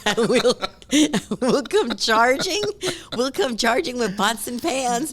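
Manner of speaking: loud, high, laughing through the words; real laughter breaking into the line; laughing through the joke. Real, not acted. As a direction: crack yourself up telling the joke. Laughs while telling own joke.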